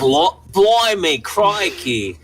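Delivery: put-on a tipsy stab at a British accent with blimey. Drunk friend egged on to do a British accent, mock British. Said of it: "I was gonna do an accent".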